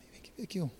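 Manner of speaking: whispered, unvoiced beckoning; secret whisper. Flight attendant whispering from behind the curtain. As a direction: call him over without anyone hearing.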